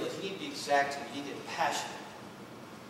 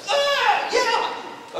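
very high, loud raven-like cry; shrieked exclamation; 7 dB louder than usual. Acted. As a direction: shriek it out in desperation. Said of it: "So this man exclaimed".

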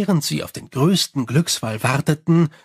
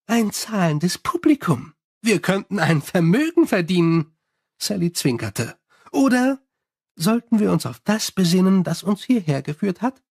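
higher, chatty vampire voice; Sally complaining about her bats, higher and chatty; 4 semitones higher than usual. Acted. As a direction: rattle on cheerfully like a gossiping vampire.

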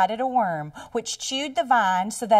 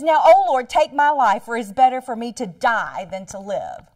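mock tantrum; 7 dB louder than usual. A narrator's reading. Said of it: "Jonah pitching a fit".

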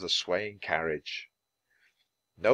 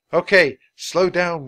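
loud, raised command; yell; 7 semitones higher, 8 dB louder than usual. A narrator's reading. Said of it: "yelled Jack".

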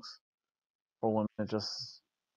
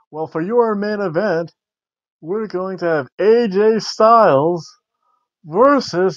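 ring announcer voice; 6 semitones higher, 5 dB louder, slower than usual. Retold or imitated. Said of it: "she's like".

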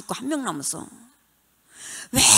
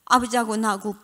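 ordinary delivery

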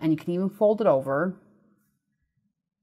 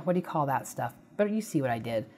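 ordinary delivery

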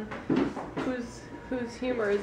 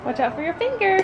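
excited praise; 5 semitones higher, 4 dB louder, slower than usual. Real, not acted. Praising baby, high and loud.

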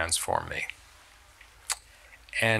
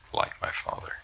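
whisper; 6 dB quieter than usual. Real, not acted. Author confiding to interviewer about becoming his father, whispered. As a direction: drop to a soft unvoiced whisper.

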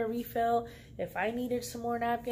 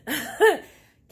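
very high, loud cheer then a laugh; gleeful woohoo; 11 semitones higher, 13 dB louder than usual. Real, not acted. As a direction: celebrate the win out loud. Winning twenty bucks at slots.

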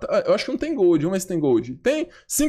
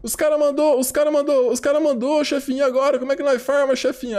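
higher-pitched mock plea to the boss; put-on pleading, pitched up; whiny underlings; 4 semitones higher than usual. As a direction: imitate followers pestering their little boss. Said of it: "Os caras devem tá lá assim".

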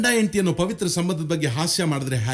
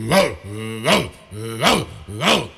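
short, sudden imitated barks; dog barking; 6 semitones lower, slower than usual. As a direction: bark like a guard dog at the door. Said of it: "ಬಾಗ್ಲಲ್ಲಿರೋ ನಾಯಿ".

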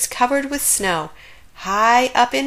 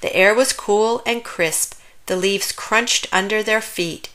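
usual speaking voice

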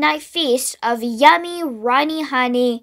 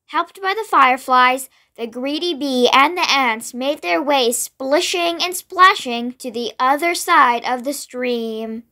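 ordinary delivery, nothing marked

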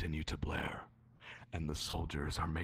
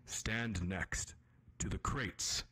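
game master narration. A narrator's reading. GM offers a choice.

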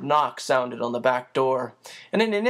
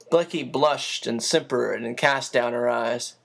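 usual speaking voice

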